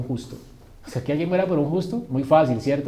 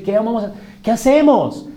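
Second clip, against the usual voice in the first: pregunta angustiada, aguda y en alto; actúa el pánico de los culpables; multitud temblando; 8 semitones higher, 5 dB louder than usual. Acted. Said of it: "Todos estaban temblando". (súplica alarmada, teatralizada)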